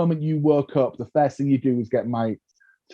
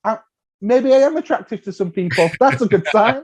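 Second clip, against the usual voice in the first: high, surprised self-talk; musing aloud at home, pitched up; imagining himself; 10 semitones higher than usual. Retold or imitated. Alone at home, marvelling to himself, high and pleased. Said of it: "you're just there at home going".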